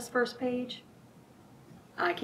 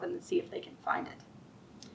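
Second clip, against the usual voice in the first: whisper; 7 semitones higher, 11 dB quieter than usual. Acted. Telling kids to hide scarf.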